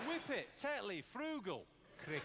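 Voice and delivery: put-on listed words in Yorkshire accent. Comedian listing Yorkshire clichés to the crowd, Yorkshire accent. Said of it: "My favourite Yorkshire phrase".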